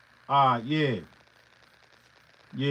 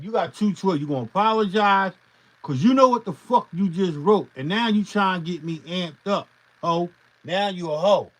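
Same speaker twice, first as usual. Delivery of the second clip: louder, heated rant that keeps building; angry telling-off; 3 semitones higher, 7 dB louder than usual. Real, not acted. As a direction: tear into him and don't let up. Berating a commenter over his message.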